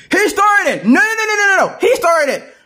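very high, heated denial; squabbling protest. Acted. Friends blaming each other to Bugs, squabbling protest.

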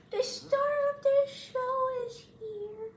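high, slow cooing voice; pet talk. Real, not acted. Greeting her pet boy Hardy in high baby talk.

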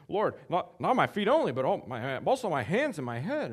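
high, loud and eager; eager Peter. Acted. Preacher voicing Peter eagerly begging Jesus.

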